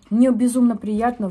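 ordinary talk, nothing marked

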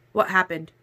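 ordinary delivery

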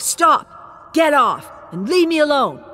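high, loud cries to stop; crying out. Acted. Dolores crying out under attack, loud and high. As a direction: yell at her attacker to get off. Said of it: "ignoring Dolores's cries of".

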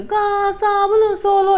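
high, loud singsong street cry; hawker's call. Retold or imitated. Imitating northern soap hawkers, high ringing call. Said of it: "all those soap that people say".

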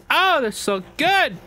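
high, loud, drawn-out delight; slow, squealing rave about a favourite drink; gushing. Real, not acted. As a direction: swoon over the taste. Gushing over energy drink.

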